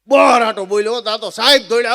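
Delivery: normal talking voice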